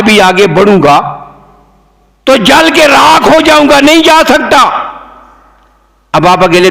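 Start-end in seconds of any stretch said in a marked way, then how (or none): 2.2-4.7 high, emphatic declamation; impassioned preacher's refusal. Acted. Preacher voicing Gabriel refusing to go further, declaiming. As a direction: declare the limit in a raised pulpit pitch.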